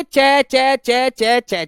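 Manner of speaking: each चै stressed high and loud; repeated sound drill. Shown as a demonstration. Teacher drilling the repeated sound चै for the class, stressed high and loud. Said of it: "चै बार बार आई".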